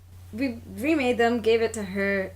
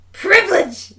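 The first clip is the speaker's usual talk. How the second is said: mock exclamation; 5 semitones higher, 8 dB louder than usual. Teller's mocking thought about customer.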